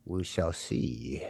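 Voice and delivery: slow, low, quiet mock menace; ominous low tone. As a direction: draw it out low and soft. Acted rival before the duel.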